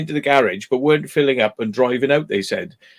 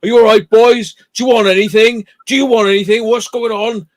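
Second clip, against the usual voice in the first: higher, louder, eager pitch of street hustlers; pushy Albanian men; 8 semitones higher, 6 dB louder than usual. Retold or imitated. Albanians pressing the lads at the garage, loud and pushy. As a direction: push in on them, loud and keen. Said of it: "some Albanians came up and they went".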